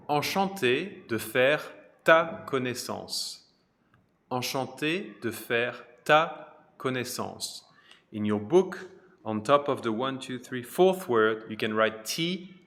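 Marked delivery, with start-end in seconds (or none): 0.1-7.6 Enchanté de faire ta connaissance, slow and clear with ta stressed; slow French model phrase. Shown as a demonstration. Teacher showing the tu form Enchanté de faire ta connaissance, slow and clear. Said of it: "here's how you say it with tu instead".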